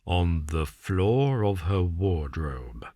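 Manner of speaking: slow and far-off, each word dropped apart; broken, halting words. A narrator's reading. Holmes repeats a clue to himself, slow and broken. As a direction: repeat it as if lost in thought. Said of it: "repeated in broken words".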